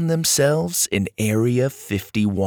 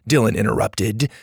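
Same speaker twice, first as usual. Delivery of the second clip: ordinary delivery, nothing marked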